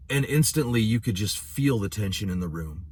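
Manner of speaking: ordinary talk, nothing marked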